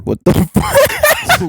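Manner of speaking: high-pitched, drawn-out squeal of disbelief; mock disbelief. Reacting to homes that don't have pets, mock disbelief. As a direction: squeal it slowly, way up high, in comic outrage.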